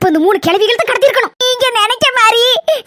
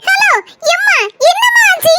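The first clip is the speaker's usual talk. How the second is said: higher worried voice of the other woman; worried caller; 9 semitones higher than usual. Acted. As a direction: ask anxiously as the caller. Voice of caller on phone. (போனில் பதற்றமாக கேட்கும் பெண் குரல்)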